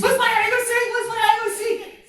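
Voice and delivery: put-on high, loud kid voice chanting; eager boy. Boy begging the teacher to play hide and seek, eager high pleading. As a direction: pester the teacher in a high excited child's voice. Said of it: "And then that boy's".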